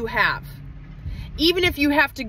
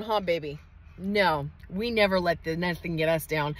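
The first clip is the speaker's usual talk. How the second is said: tender to son; 7 semitones lower, 4 dB quieter than usual. Real, not acted. Mother turns to her son.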